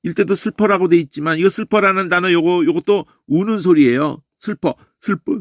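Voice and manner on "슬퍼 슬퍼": higher, sniffly sobbing 슬퍼, 슬퍼; sniffling sob demo. Shown as a demonstration. Preacher showing the weeping sound of the word, sniffling. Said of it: "슬퍼 할 때 그게 훌쩍훌쩍거리는 소리야".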